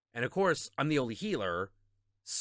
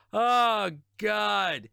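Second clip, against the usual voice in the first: loud, higher cry of disbelief; exasperated groan; 7 semitones higher, 7 dB louder, much slower than usual. Real, not acted. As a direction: throw it out in despair. Exasperated outburst.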